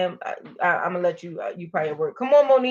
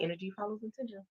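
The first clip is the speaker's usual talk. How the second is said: quiet inner mantra; 14 dB quieter, faster than usual. Shown as a demonstration. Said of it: "You just say it in your mind".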